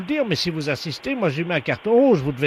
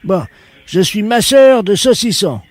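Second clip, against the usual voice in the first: loud, put-on voice of an odd shop worker; a loud prank caller playing it dead serious; 3 semitones higher, 7 dB louder than usual. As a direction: fake tradesman on the phone, loud.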